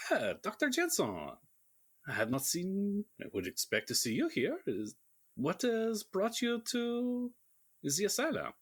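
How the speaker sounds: outrageous stage French, arms spread wide in welcome; French accent. Acted. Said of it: "Laugh at my very good French accent".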